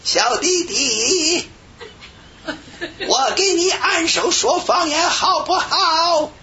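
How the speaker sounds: put-on high, drawn-out foreign-accented Chinese; foreigner's Chinese accent. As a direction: imitate an Australian woman's slow, singsong Chinese accent. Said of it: "外国人会说几句中国话". (高声慢速模仿外国人中文口音)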